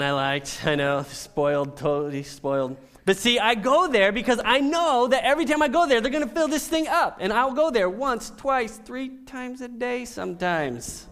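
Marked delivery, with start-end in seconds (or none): 3.1-11.1 much higher and louder, bursting with delight; excited. Real, not acted. Excited about refills.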